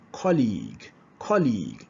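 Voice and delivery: "colleague" with a long held ee. Shown as a demonstration. Teacher modelling the long E for students. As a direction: stretch the ee in "colleague". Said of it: "the utterance must be prolonged".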